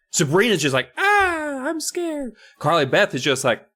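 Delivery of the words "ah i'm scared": put-on very high squeaky girl voice; high-pitched fake fright; mock scared girl.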